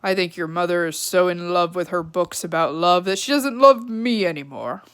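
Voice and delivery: a hint of a hurt dad complaining; father's voice. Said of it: "Flora's father had said".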